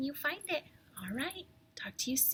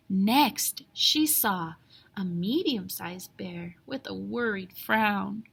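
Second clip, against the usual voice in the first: ordinary delivery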